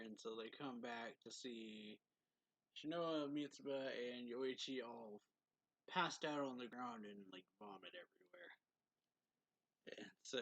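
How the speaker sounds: normal talking voice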